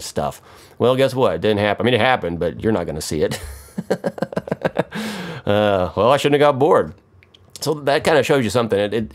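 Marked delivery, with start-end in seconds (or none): 3.8-5.4 long, high, soft giggle; amused laugh. Real, not acted. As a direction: giggle at your own mishap. Laughs at viewers missing the moment.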